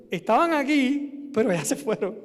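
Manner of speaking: loud, high calling voice; imitated call across distance. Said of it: "como diciendo".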